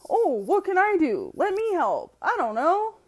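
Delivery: high, eager mock newbie voice; sprightly rookie. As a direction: play an over-keen, helpless new recruit. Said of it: "He's just sprightly".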